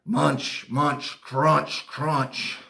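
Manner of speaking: heavy, punched chomping words; monster munching. Acted. Storyteller imitating monster eating the school, heavy chomping. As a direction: chomp out each word separately.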